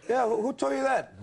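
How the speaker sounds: high, evasive stumbling reply; busted comeback. Retold or imitated.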